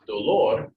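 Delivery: "dolor" punched on the last syllable, do-LOR; stress on LOR. Shown as a demonstration. Teacher demonstrating the accent rule, "dolor" stressed on LOR. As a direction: lean loudly on the end of "dolor". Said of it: "lor gets the accent".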